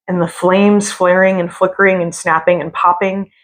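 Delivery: usual speaking voice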